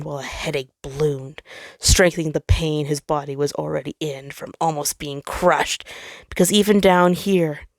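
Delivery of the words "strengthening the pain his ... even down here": plain reading voice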